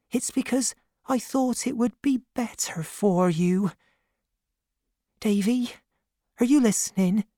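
high-pitched, hushed woman's voice; tender nursemaid Peggotty, apologetic. A narrator's reading. Peggotty says sorry to Davy through the door, tenderly.

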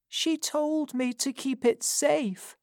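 put-on high old crone voice, confessing; an old woman unburdening a secret; dying old woman. A narrator's reading. Old Sally confessing to Missus Corney, high crone voice.

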